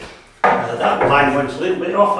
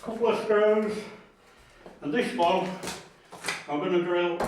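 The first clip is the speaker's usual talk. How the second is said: talking around a pencil; pencil in mouth; 3 semitones higher, 9 dB quieter than usual. Real, not acted. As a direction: pencil in mouth, words slightly muffled. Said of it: "sorry, I've got a pencil in my mouth".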